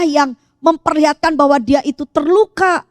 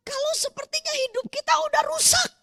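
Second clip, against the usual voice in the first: very high, soft voice; hushed plea; 12 semitones higher, 8 dB quieter than usual. Real, not acted. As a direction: soften and lift it into a plea. (lirih memohon, nada tinggi)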